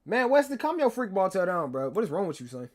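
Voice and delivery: high, loud, playful telling-off; mock scolding. Streamer telling off a chat member, mock scolding. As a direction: raise your voice at him, half-joking.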